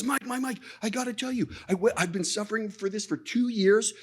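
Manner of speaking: a friend bursting with good news; excited friend. Retold or imitated. Organizer running up to Mike at the conference, excited.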